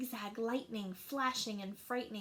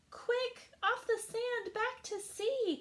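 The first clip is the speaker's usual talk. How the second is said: much higher, urgent snail voice; panicked little snail pleading; urgent cry; 12 semitones higher, slower than usual. Acted. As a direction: hurry the whale back to the sea. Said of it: "cried the snail".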